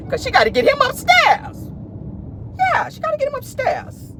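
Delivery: very high, laughing squeal; high giggly punchline. Real, not acted.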